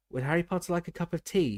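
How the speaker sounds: high squeaky house-elf voice; squeaky Dobby voice. A narrator's reading. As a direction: squeak it out over the sobbing. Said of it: "he squeaked loudly".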